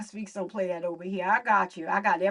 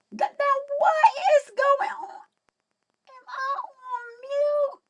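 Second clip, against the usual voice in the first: high voice filter; 3 dB louder than usual. Real, not acted. Streamer confused about her sound, through a high filter. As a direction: voice pitched way up by an app filter.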